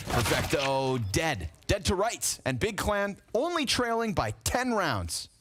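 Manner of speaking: animated caster voice, swooping up on the kills; live play-by-play. Real, not acted. Caster narrates the clutch attempt, animated play-by-play. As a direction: drive the call through to the big moment.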